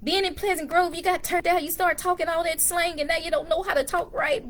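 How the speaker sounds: high-pitched scolding mother; mom's high, fussing voice; imitating mother. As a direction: mimic mom telling you off, pitched well up. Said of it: "She was like".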